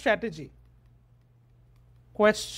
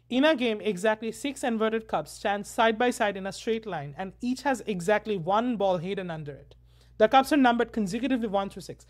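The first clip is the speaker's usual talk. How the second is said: reading straight through; slower than usual. Shown as a demonstration. Teacher showing students reading without comprehension, straight through. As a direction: recite continuously, merely pronouncing the words. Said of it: "এই যে ধরো আমি পড়ে যাচ্ছি".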